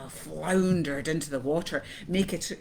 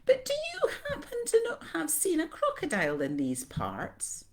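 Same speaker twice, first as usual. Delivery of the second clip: high-pitched, eager-to-please question; little elephant's courteous question; polite child; 11 semitones higher than usual. A narrator's reading. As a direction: ask very politely in a high young voice. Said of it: "most politely".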